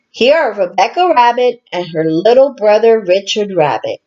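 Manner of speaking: usual speaking voice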